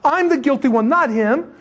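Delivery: high, impassioned acted plea; urgent pleading. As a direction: beg passers-by to listen, pitch way up. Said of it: "he'd be pulling on people".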